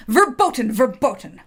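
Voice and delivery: loud furious German shout. Acted. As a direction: bellow it at the soldier.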